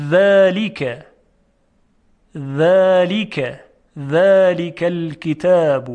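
ذلک slow and loud, light voiced th; delicate dhal drill. Shown as a demonstration. Teacher leading students to repeat, slow delicate ذلک. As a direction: stretch ذلک for the learners to copy. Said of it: "شما هم تکرار کنید".

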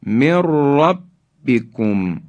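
من ربکم as mirrabbikum; nun merged into ra. Shown as a demonstration. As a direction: trill from من into ربکم, no n. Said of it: "را ادغام شد".

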